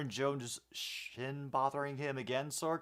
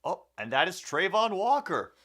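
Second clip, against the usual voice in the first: sudden hyped sportscaster call; excited call; 7 semitones higher, 6 dB louder than usual. Acted. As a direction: shout out the big play. Big play in the game.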